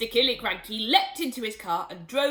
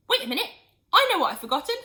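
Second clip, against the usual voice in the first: sudden realisation; 9 semitones higher, 9 dB louder, much faster than usual. Acted. George remembers suddenly.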